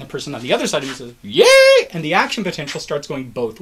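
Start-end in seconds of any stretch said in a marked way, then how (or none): 1.2-1.9 very high, loud mock cheer; full-volume squealed cheer; wave cheer. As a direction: burst into a pretend stadium "yay". Other neighbour joins the wave.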